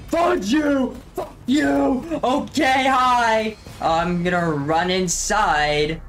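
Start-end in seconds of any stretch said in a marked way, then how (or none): 0.1-2.2 startled shriek at a jump scare; scared horror game streamer. Real, not acted. 2.2-3.6 shaken, high and breathless; scared horror game streamer. Real, not acted. Right after the jump scare.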